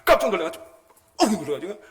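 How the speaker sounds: loud, high burst of alarm; a jolt of fright on 깜짝; startled exclamation. Retold or imitated. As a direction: blurt it like you just got cut off. Retelling a car cutting in.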